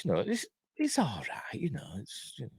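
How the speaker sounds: half-hearted whispered verdict; grudging under-the-breath praise of the sword fight; whispered aside. Real, not acted. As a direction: whisper it, unimpressed.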